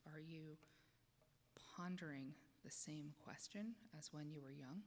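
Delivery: soothing voice that invites the congregation to close their eyes; guided meditation. Acted. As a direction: ease them gently inward, dropping to a whisper at times.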